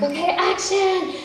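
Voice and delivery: high, drawn-out director's call; calling action. Retold or imitated. Retells calling action on set.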